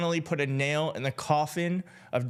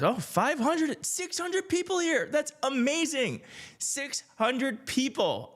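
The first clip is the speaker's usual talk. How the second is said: higher, delighted disbelief; real happy surprise, slow; excited; 7 semitones higher than usual. Real, not acted. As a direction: marvel at the number of viewers. Sees viewer count.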